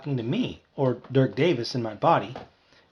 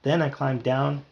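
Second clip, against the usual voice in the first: plain reading voice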